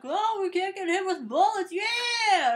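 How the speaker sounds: high, loud mock celebration; mock cheering. Turtles finding they are bulletproof, high mock-triumphant shout. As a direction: whoop it up, building to a shouted yeah.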